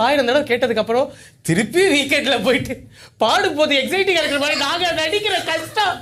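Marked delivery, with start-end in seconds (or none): 3.2-6.0 high-pitched, hammed-up wail of suffering; mock tearful complaint. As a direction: plead as if close to tears. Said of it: "அழாதீங்கம்மா". (அழுவது போல புலம்பல்)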